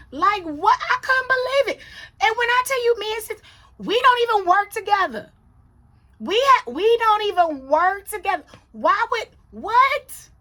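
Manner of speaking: high, loud disbelief; baffled, high-pitched protest; incredulous outburst. Real, not acted. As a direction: exclaim it like you can't believe what you heard. Reliving disbelief at coworker's dislike.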